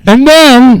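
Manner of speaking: high, very loud burst. Real, not acted. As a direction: blast it into the mic. Repeats it louder into the microphone.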